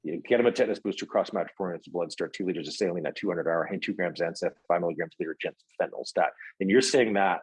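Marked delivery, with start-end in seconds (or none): 0.0-6.3 rapid-fire TV doctor orders; brisk run of memorized jargon; rattled-off medical jargon. Shown as a demonstration. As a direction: reel off the medical orders without a break. Rattles off remembered doctor show lines.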